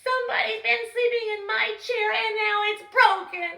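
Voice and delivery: high, loud squeaky cub voice; little Baby Bear. Acted. Baby Bear finding his empty bowl, high squeak. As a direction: squeak it out like a tiny upset cub.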